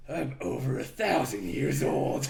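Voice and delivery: slow, lightly put-on gruff hero voice; gruff movie hero. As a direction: imitate an awful dramatic line reading, drawn out. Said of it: "he's got the gruffest, like, awful voice".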